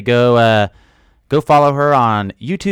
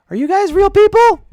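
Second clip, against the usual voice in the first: high mock squeal; 5 dB louder than usual. Streamer teasing viewers, asking if they're real, in a high squeal.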